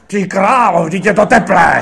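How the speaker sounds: high, loud explosion of outrage; hammed-up imitation of a furious director; angry outburst. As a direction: blow up suddenly over the drink. Director explodes over warm soda.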